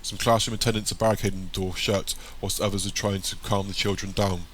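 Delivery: plain reading voice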